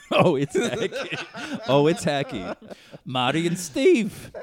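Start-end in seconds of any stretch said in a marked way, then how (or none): 0.1-2.5 laughing. Real, not acted. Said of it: "that's just hacky".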